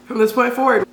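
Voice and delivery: hiccups breaking into speech; talking through the hiccups; hiccuping while eating. Real, not acted. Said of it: "you're probably gonna hear me hiccuping now".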